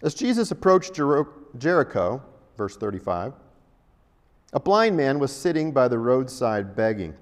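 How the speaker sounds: ordinary delivery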